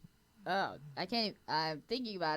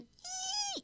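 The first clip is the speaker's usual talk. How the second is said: very high thin squeal; high fangirl squee; 4 dB quieter than usual. Shown as a demonstration. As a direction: squeak it like a giddy fan. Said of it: "I think it was both".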